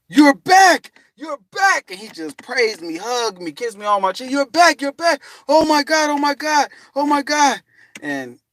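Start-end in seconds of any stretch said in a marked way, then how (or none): 0.1-1.9 high, loud overjoyed cry; joyful shout. Retold or imitated. Girlfriend's dad greeting him, overjoyed shout. 4.3-7.6 high, loud overjoyed cry; joyful shout. Retold or imitated. Girlfriend's dad greeting him, overjoyed shout.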